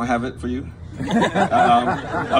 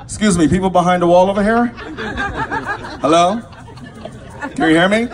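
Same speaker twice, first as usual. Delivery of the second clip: loud, raised call to people out of sight; 4 semitones higher, 4 dB louder than usual. Real, not acted. Comic to people behind the wall, calling out.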